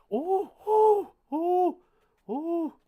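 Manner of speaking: loud, high, startled oh's as notes spill out; real alarm at the falling notes; startled cries. Real, not acted. Collector sees notes fly out, loud startled cries.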